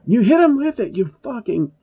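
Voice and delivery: put-on high girlish yell; Asuna screaming. A narrator's reading. Asuna yells at Phil how to use the sword, high-pitched. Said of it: "Asuna screams at Phil".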